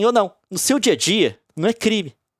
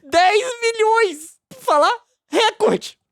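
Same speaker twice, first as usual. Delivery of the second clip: much higher, slow, incredulous voice; mock outrage; 8 semitones higher than usual. Scoffing at the ten-million fine for viewers. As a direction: ham up disbelief at the fine.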